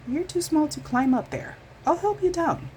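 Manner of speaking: a kindly fox offering help; Fox's voice. A narrator's reading.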